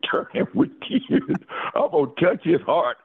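high, laughing through the words; chuckling at his own plan. Real, not acted. Telling Rich his plan, laughing.

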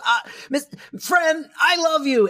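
high-pitched, hammed-up outburst; mock impassioned declaration. Mister Sheffield pulling away from the kiss, mock passionate. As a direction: blurt it like a melodramatic lover.

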